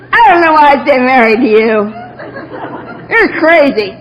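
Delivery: exasperated husband snapping at his wife; drunk husband scolding. Retold or imitated. Husband's drunken rebuke quoted.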